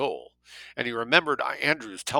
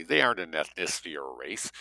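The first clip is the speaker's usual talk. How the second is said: ordinary delivery, nothing marked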